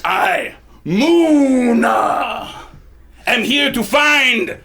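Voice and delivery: put-on loud, slow minotaur with a French accent; Muna, French accent. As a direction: proclaim it grandly in a French accent. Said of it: "Muna also has a French accent".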